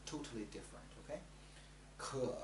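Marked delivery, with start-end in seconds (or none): 2.0-2.3 可 pitched low with a dip; third tone. Shown as a demonstration. Teacher drilling 可, low dipping third tone. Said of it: "third tone".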